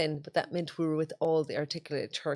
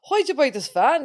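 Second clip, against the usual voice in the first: mock mechanic; 7 semitones higher, 6 dB louder than usual. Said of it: "they'd be like".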